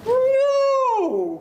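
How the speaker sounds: high, long-held "no"; stretched storybook refusal; defiant big goat. Acted. Big goat defies the troll.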